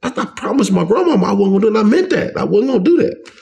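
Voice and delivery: high-pitched, rushed, disbelieving protest; retold indignant denial. Inmate denying drug dealing to the officer, acted out as indignant disbelief.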